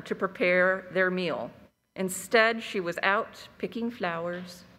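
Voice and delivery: calm, measured storytelling voice; story narration. A narrator's reading. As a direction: tell it like a fireside legend. Reads a Cherokee legend aloud.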